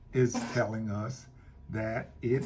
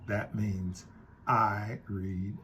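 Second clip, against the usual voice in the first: normal talking voice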